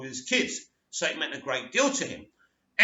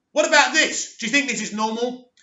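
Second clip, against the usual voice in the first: louder, higher acted frustration; frustrated outburst; 7 semitones higher, 7 dB louder than usual. Upset son challenging his father about being normal, frustrated outburst. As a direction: burst out in challenge, retelling a frustrated teen. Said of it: "Clark becomes very, very frustrated".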